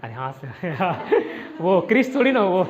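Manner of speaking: real laughter breaking into the words; laughing. Real, not acted. Amused aside about catching fish.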